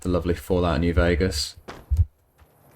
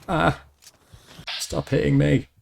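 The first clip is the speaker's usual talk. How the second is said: being chased; 8 semitones higher than usual. Real, not acted. Ghouls hitting him.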